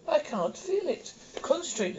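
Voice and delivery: high, eerie giant spider voice; female-pitched spider voice. Acted. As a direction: speak as a high, otherworldly spider. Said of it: "spider speaks with a female pitched voice".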